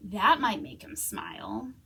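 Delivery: ordinary delivery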